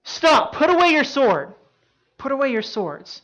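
loud, high command, easing slightly at the end; yelling. Retold or imitated. Jesus stopping Peter's sword attack, yelling. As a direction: shout to halt a fight at once. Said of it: "Jesus yells".

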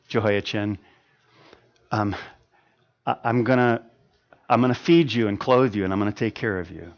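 soft, gentle reassurance from the king; kind king speaking softly. Acted. King speaks kindly to the freed prisoner, soft and gentle. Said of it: "he spoke kindly to him".

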